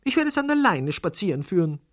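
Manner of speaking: put-on young lady. A narrator's reading. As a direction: claim it in a high feminine voice. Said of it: "sagte sie".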